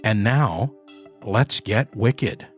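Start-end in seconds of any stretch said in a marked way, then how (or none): none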